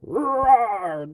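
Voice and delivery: loud, high hammed-up roar; monster roar.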